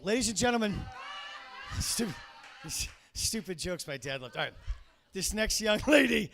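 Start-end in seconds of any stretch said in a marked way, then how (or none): 0.8-1.7 high shrieking laugh; high squeal laugh. Real, not acted. Laughs at own joke.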